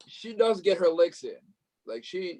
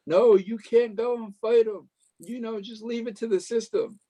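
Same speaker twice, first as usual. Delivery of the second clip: pleading daughter; 7 semitones higher, 4 dB louder than usual. Retold or imitated. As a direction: imitate a daughter begging her mother to stop. Voices the daughter pleading with Lori.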